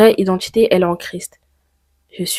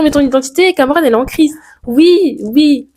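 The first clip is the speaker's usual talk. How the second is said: plus haut et plus fort, animé; objection imitée avec entrain; 7 semitones higher, 4 dB louder than usual. Pretend. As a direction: imite le public qui la reprend. Objection imaginée, réponse animée. (reprend l'objection du public, voix haute et animée)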